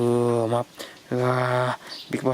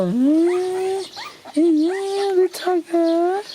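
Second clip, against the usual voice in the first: higher, drawn-out cooing; 12 semitones higher, much slower than usual. Real, not acted. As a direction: coo slowly at the little one. Cooing at little girl.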